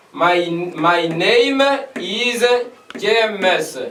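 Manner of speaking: "My, my name is James" repeated at half pace; slow it right down for students to copy. Shown as a demonstration. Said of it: "l'exemple de dire".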